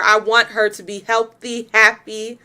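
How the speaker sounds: usual speaking voice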